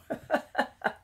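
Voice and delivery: short airy chuckle; breathy little laugh; soft laugh. Real, not acted. Laughs about window.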